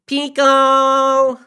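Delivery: long, high, full-volume call; shouting. A narrator's reading. Ruiz calling out for his brother in an empty building, shouting. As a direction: holler the name across a cavernous room. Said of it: "Ruiz shouted into the cavernous room".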